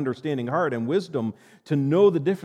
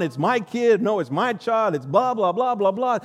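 mocking the bickering; 7 semitones higher, 5 dB louder than usual. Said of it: "I'm King Solomon. I'm going".

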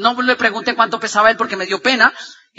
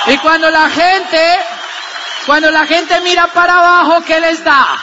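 rousing shout; 4 semitones higher, 3 dB louder than usual. Real, not acted. Speaker rousing the audience.

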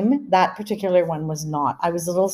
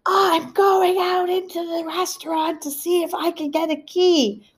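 shouting to deaf mom; 12 semitones higher, slower than usual. Retold or imitated. Daughter shouting to her hard-of-hearing mother. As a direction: raise it up so she can hear.

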